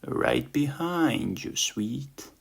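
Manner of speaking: teasing ladybug. Acted. Dara teasing, slow and high.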